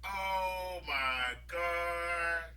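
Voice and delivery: slow soft dismay; slow, soft oh my God; embarrassed. Retold or imitated. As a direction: groan it out slowly. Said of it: "thought to myself".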